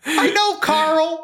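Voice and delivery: high, cartoonish whiny voice; high nasal cartoon sass; Master Shake voice. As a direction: do a petulant cartoon milkshake. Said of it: "in Master Shake's voice".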